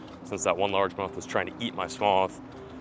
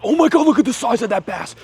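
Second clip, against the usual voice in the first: much higher and louder, blurted out in shock; a real gasp of amazement, shouted; astonished shout; 13 dB louder than usual. Real, not acted. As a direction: yell in sheer disbelief at the size. Spots a huge bass.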